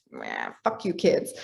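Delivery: very low, creaky mock grumble; mock grumbling. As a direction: grumble at the kids in pretend annoyance. Acting grumpy mom reaction.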